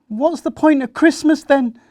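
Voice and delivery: put-on a child wailing that Christmas is pointless; upset outburst. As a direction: blurt it out in a hurt rage. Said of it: "Sam thought".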